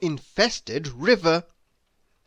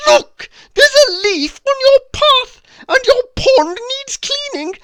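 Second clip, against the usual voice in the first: much higher, louder, nagging fusspot; fussy neighbour nags much louder and higher; Mister Fussy's bossy voice; 9 dB louder than usual. Acted. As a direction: scold every little fault in a shrill-high, loud voice. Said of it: "a rather bossy voice".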